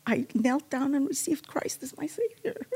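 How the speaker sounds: real tears rising while testifying; emotional. Real, not acted. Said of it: "I don't know why I'm getting emotional now".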